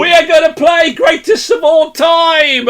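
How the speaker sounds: big, high-pitched showman's shout; loud hyped-up host intro; game-show announcer shout. Pretend. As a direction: belt out the game's title to a crowd.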